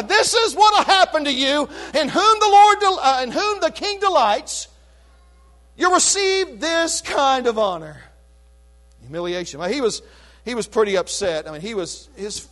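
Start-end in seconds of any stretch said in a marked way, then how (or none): 0.0-8.0 mock public proclamation, a bit louder and higher; hammed-up town-crier call; mock crier, lightly laughing; crying out. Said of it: "he's crying out to every person".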